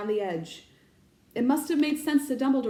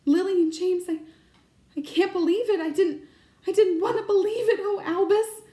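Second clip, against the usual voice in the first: higher, louder, voice near breaking with grief; stunned, fighting back tears; distraught; 9 semitones higher, 5 dB louder than usual. Acted. McGonagall learning the Potters are dead, distraught and higher.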